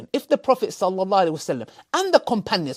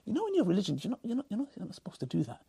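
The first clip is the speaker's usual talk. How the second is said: hushed retelling; 12 dB quieter, faster than usual. Retold or imitated. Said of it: "non-Muslims come and say".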